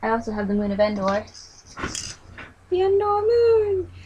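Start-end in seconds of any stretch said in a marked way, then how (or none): 2.7-3.9 high, slow excited announce. Real, not acted. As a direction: hold it up and cheer. Showing off Endor moon set.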